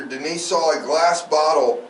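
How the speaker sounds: ordinary talk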